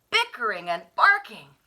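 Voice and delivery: plain reading voice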